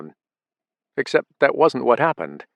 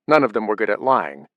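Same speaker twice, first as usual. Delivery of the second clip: usual speaking voice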